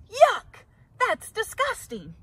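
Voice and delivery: loud high yuck; disgusted. A narrator's reading. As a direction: spit out the disgust. Bird rejects the sandwich.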